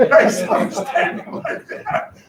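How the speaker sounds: high breathy laughing sarcasm; incredulous laughing retort; laughing mock reply. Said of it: "That would be my response as a student".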